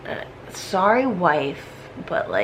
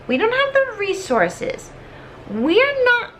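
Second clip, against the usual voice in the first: rising mock plea; 10 semitones higher than usual. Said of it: "So Hamilton's just like".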